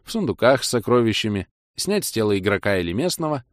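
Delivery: ordinary delivery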